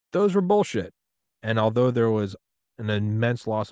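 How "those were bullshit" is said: higher, louder, emphatic dismissal; mock outraged verdict. People looking back on past wars, emphatic dismissal. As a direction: call it out loudly, pitched up.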